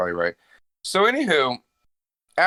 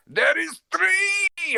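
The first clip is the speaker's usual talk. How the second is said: triumphant shout; much slower than usual. Acted. Dracula counting monoliths, a hammed-up shout. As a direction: belt out the number like a showman. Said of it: "Arnold Schwarzenegger saying California".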